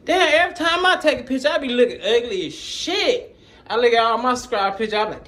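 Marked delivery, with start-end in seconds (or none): 0.1-3.4 self-mocking laugh. Real, not acted. Laughing self-mockery over his photos.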